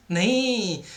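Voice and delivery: long, slow teacherly no; drawn-out refusal. Acted. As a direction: stretch the no out to correct a pupil. Teacher mock-corrects the imagined student. (लंबा खींचकर समझाते हुए मना करना)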